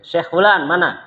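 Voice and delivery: calls the name out loud and high; calling out. Retold or imitated. The Syekh summons the student's teacher, calling out loudly. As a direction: summon the teacher across the room. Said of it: "Langsung dipanggil Syekhnya".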